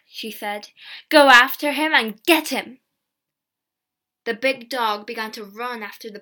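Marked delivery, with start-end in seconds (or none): 1.1-2.8 loud command. A narrator's reading. Girl sets dog on peddler.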